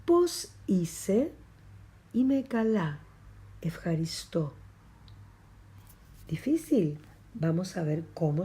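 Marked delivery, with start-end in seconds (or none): 3.6-4.5 whispered word. Shown as a demonstration.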